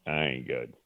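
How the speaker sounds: low, soft, choked-up admission; barely holding back tears. Retold or imitated. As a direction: let the voice break on it. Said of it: "and I'm like".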